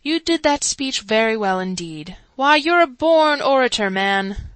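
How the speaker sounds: butter him up; crafty flatterer. A narrator's reading. Sub-Warden flattering Chancellor.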